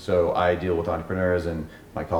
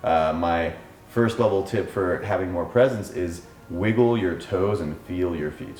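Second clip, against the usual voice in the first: ordinary talk, nothing marked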